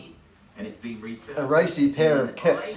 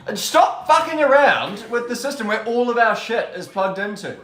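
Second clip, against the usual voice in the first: higher-pitched, exasperated scolding; exasperated telling-off; 9 semitones higher, 4 dB louder than usual. Real, not acted. Co-host protecting the plugged-in stream setup, exasperated telling-off. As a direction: tell them off, voice pitched up in alarm.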